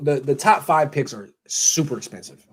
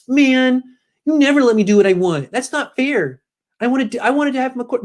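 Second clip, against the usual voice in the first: high-pitched whiny sulk, mocking a coach; whining coach; 10 semitones higher than usual. Host mocks the coach never getting what he wants, high whine. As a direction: whine it high like a thwarted kid. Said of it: "Stop whining, Kyle".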